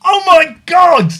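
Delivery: high, drawn-out yell of disbelief; outraged shout. Real, not acted. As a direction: shout in exasperation at an instant blunder. Opponent loses game at once.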